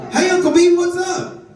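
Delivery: put-on very high little-kid greeting; six-year-old niece. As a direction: chirp it high like a small girl. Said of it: "she's just standing in the middle".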